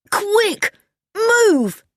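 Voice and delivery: high-pitched urgent cry; urgent shout. A narrator's reading. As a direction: call out a sharp order to hurry. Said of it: "shouted D J".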